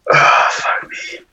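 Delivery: slow, breathless whispered groan; exhausted groan. Retold or imitated. To himself while painting, a slow exhausted whispered groan. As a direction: sag into a tired whispered curse. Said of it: "And I'm just like".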